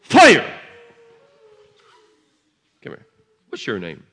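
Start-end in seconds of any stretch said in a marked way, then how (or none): 0.0-0.5 high, loud shout of fire. Real, not acted. Preacher shouting fire over a child, loud and high. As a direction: blast it out like a command.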